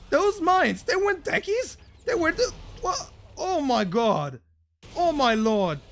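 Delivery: high-pitched, loud shocked cries; stunned disbelief. Real, not acted. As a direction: gasp it out, can't believe the mines. Caster stunned by surprise mines.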